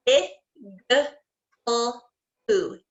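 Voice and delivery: "I, g, l, oo" said as separate sounds; sounded out letter by letter. Shown as a demonstration. Teacher sounding out igloo for young students, one sound at a time. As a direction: break igloo into its sounds, pausing between each. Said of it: "do igloo".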